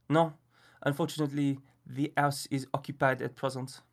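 put-on fussy French gentleman, refined French accent. A narrator's reading. Miller refuses the birthday boy in French accent. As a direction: turn the boy away in a prim French accent. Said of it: "a strange Frenchman in the Wendy house".

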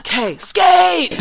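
high, slow, drawn-out yell at the rink; cheerleader yell. Retold or imitated. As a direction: stretch out skate like calling across the ice. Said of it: "I have this huge cheerleader voice".

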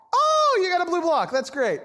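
high, bright, loud delight; delighted parent. Retold or imitated. Parent gushing loudly at the toddler, overjoyed. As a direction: gush over the child's news.

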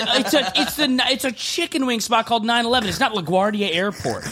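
high, indignant ranting; reliving the fight over a water bottle, indignant and high; mock outraged rant. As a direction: rant like the rule is absurd. Retold rant, high pitch.

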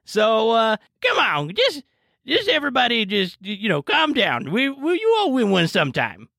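put-on old lady host hushing her players; bingo host. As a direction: settle down a rowdy old crowd. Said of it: "the host is like".

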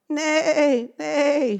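loud, high neighing; horse neigh. Acted. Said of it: "said the horse".

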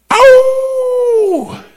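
high falsetto yelp; whooping preacher cry. Real, not acted. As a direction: let out a sharp joyful howl.

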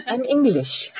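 laughing through the words. Real, not acted. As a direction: giggle it out, amused at herself. Laughing at own confession.

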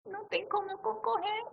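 high, weepy whimper of defeat; mock crying. Repeating her defeat to the hosts, in a high weepy whimper. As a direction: whine it like a sob.

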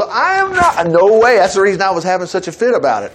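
ordinary talk, nothing marked

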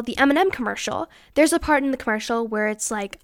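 ordinary talk

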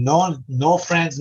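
ordinary talk, nothing marked

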